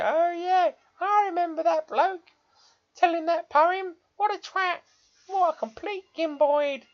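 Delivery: put-on high, loud old man voice. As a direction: play a grumpy old man looking back. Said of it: "that was my old man voice".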